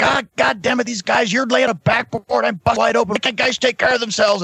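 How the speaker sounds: high-pitched mock wrestling rant; imitated angry promo. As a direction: mimic a worked-up wrestler cutting a promo. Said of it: "he's cutting a promo".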